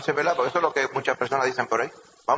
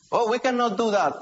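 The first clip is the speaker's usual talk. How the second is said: put-on high protest in an Israeli accent; imitated Israeli accent; 8 semitones higher than usual. Israeli colleagues give up on the rolled R, imitated accent. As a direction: mimic an Israeli-accented speaker giving up. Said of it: "Los de Israel".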